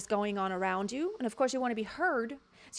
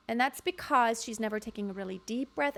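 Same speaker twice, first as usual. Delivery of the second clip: usual speaking voice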